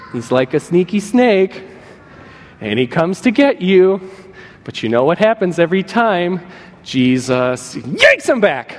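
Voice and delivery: put-on playful story voice for children; lightly voiced kid-story delivery; storytelling to kids. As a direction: spin it like a bedtime tale.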